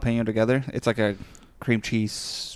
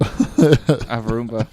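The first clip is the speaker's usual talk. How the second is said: slow, laughing Swedish accent Roomba, drawn-out "Roomba" with a v sound; 7 dB louder, much slower than usual. Shown as a demonstration. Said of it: "What's, like, the Swedish version".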